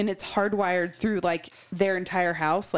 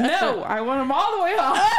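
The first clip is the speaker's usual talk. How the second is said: laughing; 6 semitones higher, 4 dB louder than usual. Real, not acted. Giggly teasing banter.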